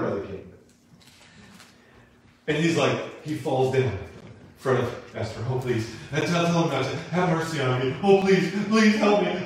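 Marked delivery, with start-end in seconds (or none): 5.5-9.4 mock pleading, building higher and louder; acted-out frantic plea; begging. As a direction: beg for mercy, more desperate each time. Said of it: "he's like begging".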